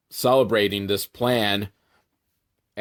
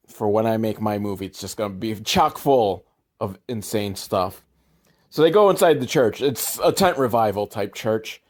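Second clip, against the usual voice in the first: ordinary talk, nothing marked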